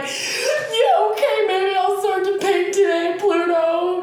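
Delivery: very high, loud teary laugh; laughing-crying yes. Acted. Artist finally agreeing to Pluto, laughing and crying. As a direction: give in through laughter and tears. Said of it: "And you're like".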